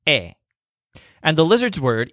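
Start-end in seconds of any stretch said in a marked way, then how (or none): 0.1-0.3 "eh" with no H sound; silent H. Shown as a demonstration. Teacher shows learners how the word is simply pronounced, silent-H "eh". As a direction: say "eh" as a simple open vowel. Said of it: "it's simply pronounced".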